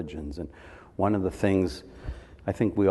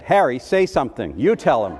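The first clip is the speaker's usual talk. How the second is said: mother pushing the father to speak up, louder; a loud, fed-up mom handing the problem to dad; mock-urgent mother's plea; exasperated mother; 5 semitones higher, 8 dB louder than usual. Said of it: "And my mother said to my dad".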